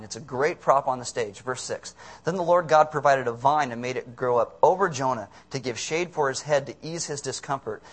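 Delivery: normal talking voice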